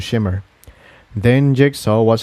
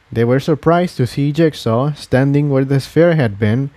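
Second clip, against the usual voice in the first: ordinary delivery, nothing marked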